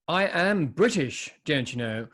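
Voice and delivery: a light put-on stuffy English gent; mock posh British. Jokingly claims to be British.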